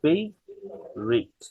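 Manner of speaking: ফেভারিট high and slow, "FAYV-rit"; ফেভারিট without the middle "a"; slow model pronunciation. Shown as a demonstration. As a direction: pause between the two parts of ফেভারিট. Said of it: "এটা উচ্চারণ ফেভারিট না".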